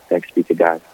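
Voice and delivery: ordinary talk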